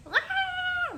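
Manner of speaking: very high sudden cry of joy; joyful cry. Retold or imitated. Mother hearing her newborn's first cry, high joyful exclamation. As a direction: let out a burst of relief at hearing the baby.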